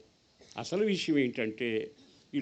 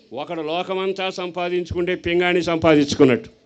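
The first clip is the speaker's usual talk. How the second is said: fervent sermon climax; preacher building to full force; preaching; 5 semitones higher, 9 dB louder than usual. Acted. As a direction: drive the point home to the congregation. Sermon application rising.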